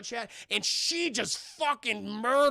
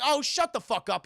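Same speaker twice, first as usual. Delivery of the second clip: snapping back; 6 semitones higher, 6 dB louder than usual. Retold or imitated. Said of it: "He's like".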